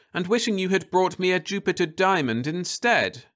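put-on high, dreamy young woman; Florence's voice. A narrator's reading. Wife musing to her husband, high and dreamy. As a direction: muse aloud in a high voice.

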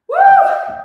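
high, loud "woo"; piercing workout whoop; excited whoop. Real, not acted. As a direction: let out a big high cheer. Instructor cheering through a workout.

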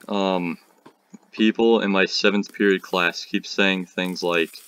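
normal talking voice

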